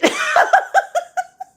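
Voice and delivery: squealing high laughter. Real, not acted. Cracking up at the polka dot joke, high laugh.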